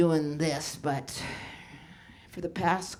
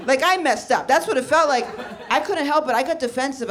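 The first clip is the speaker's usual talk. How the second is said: high, loud, laughing through it; 7 semitones higher, 6 dB louder than usual. Real, not acted. Comic explaining to crowd, laughing high. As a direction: talk through the giggles.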